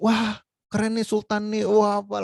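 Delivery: high-pitched imitated awe; impressed onlookers. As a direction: gush like players admiring a rare skin. Acting impressed players seeing a skin.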